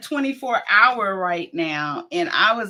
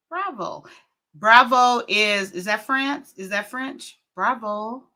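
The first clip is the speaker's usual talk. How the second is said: normal talking voice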